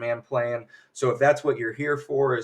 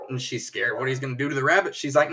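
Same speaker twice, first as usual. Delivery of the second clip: ordinary talk, nothing marked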